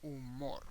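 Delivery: Spanish "Humor", last syllable stressed; Spanish pronunciation. Shown as a demonstration. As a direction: drop the h in "Humor" and stress MOR. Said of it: "the H doesn't have a sound at all".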